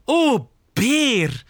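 high, drawn-out gushing cry; exclaiming. Acted. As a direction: cry out like an adoring fan. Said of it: "roept ze uit". (hoog en verrukt uitroepend)

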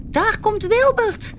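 high, drawn-out warning call. A narrator's reading. Little crab warning the reef of the whale, calling out high. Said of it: "riep Klaartje".

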